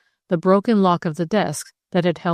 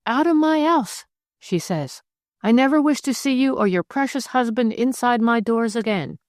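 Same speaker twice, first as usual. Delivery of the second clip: mock angry shout; 6 semitones higher than usual. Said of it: "shouting at the top of her voice".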